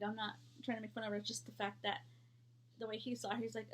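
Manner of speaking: ordinary delivery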